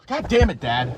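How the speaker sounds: sudden high exasperated yell; annoyed shout. Real, not acted. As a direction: snap at him, really annoyed. Dad interrupts the recording.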